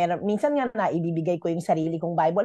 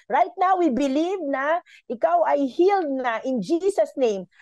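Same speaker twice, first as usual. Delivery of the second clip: higher, bold declaration of healing; proclaiming healing; 6 semitones higher than usual. Retold or imitated. To the taxi driver, declaring him healed, bold prayer. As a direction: pray it over him with faith.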